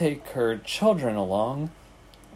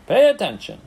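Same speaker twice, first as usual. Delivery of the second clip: high, loud urgent order; screamed; 8 semitones higher, 5 dB louder than usual. A narrator's reading. Pig to the drowsy rat, screaming. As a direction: scream it, pitch leaping up. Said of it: "screamed Wilbur".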